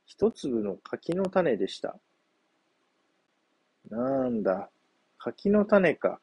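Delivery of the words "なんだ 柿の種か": drawn-out letdown, first word pitched high; disappointed monkey. A narrator's reading. Monkey finds only a persimmon seed, slow disappointed sigh. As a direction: sag with disappointment, slowly. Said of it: "がっかりした猿が".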